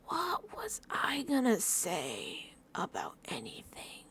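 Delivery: slow breathy whisper of a lost thought; blank-minded whisper. Retold or imitated. Vlogger acting out forgetting her point, slow whisper. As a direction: whisper it like your mind just went empty. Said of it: "Then I sit here and I'm like".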